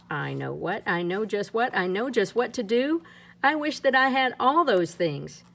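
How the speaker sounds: usual speaking voice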